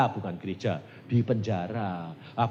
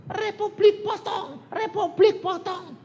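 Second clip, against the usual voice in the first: high, loud, drawn-out chant; mob war cry; 6 dB louder, slower than usual. Retold or imitated. Retelling how an Irian mob threatened him, high loud war cry. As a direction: imitate a mob shouting its threat. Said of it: "Demikian saya teriak gitu".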